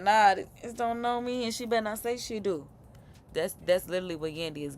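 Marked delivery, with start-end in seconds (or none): 0.0-2.7 raised, louder mock dismissal; playfully acted indignant comeback; dismissive retort. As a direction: act out an indignant brush-off. Acts out Yandy's dismissive retort.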